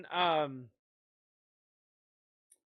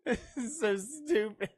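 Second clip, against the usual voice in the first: higher, helplessly amused; laughing; 7 semitones higher than usual. Real, not acted. Player reacting to stupid movie quotes, laughing. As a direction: get it out through laughter.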